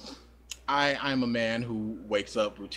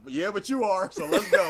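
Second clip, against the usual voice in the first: high, laughing, rising to a louder push; peppy body; 8 semitones higher, 4 dB louder than usual. Retold or imitated. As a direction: bounce up, cheering yourself on. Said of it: "You're like".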